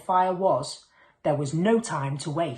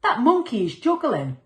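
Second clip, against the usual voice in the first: put-on excited boy; 7 semitones higher, 5 dB louder than usual. A narrator's reading. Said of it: "a boy said".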